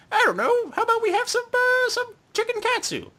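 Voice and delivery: put-on very high, squeaky girlish voice. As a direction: squeak it way up like a cartoon girl. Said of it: "And she's like".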